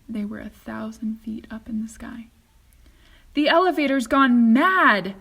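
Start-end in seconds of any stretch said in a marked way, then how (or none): 3.3-5.1 loud, panicked cry; old man frightened by the runaway lift; shouting in alarm. A narrator's reading. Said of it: "shouted Grandpa Joe".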